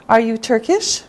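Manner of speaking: normal talking voice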